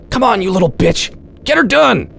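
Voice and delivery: rising to a high, pumped-up holler; self pep talk. Acted. Daniel slapping his cheek to pump himself up, rising high holler.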